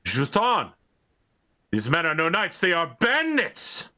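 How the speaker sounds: rising to a high outraged cry; angry accusation. Acted. GM's knight denouncing the players as bandits. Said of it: "and he says".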